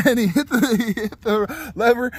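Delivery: high, loud, breaking into laughter; real laughter running through the words; laughing while talking. Real, not acted. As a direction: crack up while telling the story. Cracks up telling the mishap.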